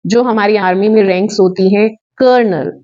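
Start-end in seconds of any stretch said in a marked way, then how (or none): none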